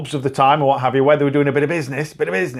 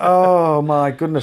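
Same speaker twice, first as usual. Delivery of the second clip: slow; much slower than usual. Pretend. Slow drawn-out exclamation.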